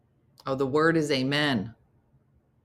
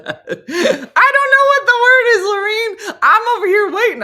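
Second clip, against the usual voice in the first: much higher, louder, crying wail; Buddy the Elf crying; 8 dB louder than usual. Retold or imitated. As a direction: wail it like a heartbroken elf to his dad. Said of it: "You sound like Buddy the Elf singing to his dad".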